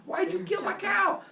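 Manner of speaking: high-pitched mock-anguished shout; outraged. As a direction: yell the accusation, voice way up. Apollo confronts the sleeping killer.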